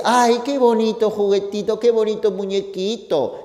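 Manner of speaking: a hint of a girl gushing over a plush toy; mild doting girl voice; girlfriend cooing. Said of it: "y la chica dice".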